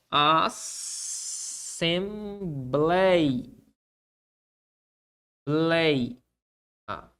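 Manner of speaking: "assembleia" drawn out, long pauses between syllables. Shown as a demonstration. Teacher showing the word's separation, "assembleia" syllable by syllable. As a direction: hold each syllable of "assembleia", then repeat "lei" apart. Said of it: "A separação se dá dessa forma".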